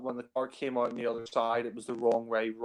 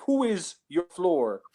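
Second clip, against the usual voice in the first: slow, higher imitation of a faked foreign accent; Connery's put-on accent; 6 semitones higher, slower than usual. Reviewer quoting Connery's comic disguise, put-on accent. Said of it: "when Connery puts on this, like, accent".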